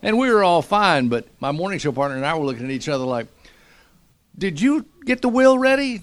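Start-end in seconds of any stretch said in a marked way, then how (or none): 4.4-6.0 high, loud joking plea; mock panicked question. To his morning show partner during the crash, mock panicked. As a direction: toss it to your partner in mock alarm.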